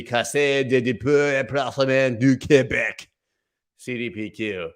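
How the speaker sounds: over-the-top mock French accent on the Quebec fund's name. Vlogger fakes a French accent to read the fund name. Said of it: "But if I did speak French".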